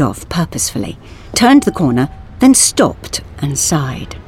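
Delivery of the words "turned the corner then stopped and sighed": ordinary delivery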